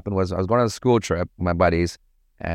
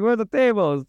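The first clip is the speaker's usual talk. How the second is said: much higher, mock-panicked owner voice; big Italian owner; 12 semitones higher than usual. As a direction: ham up the worried venue boss. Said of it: "this big fat Italian guy".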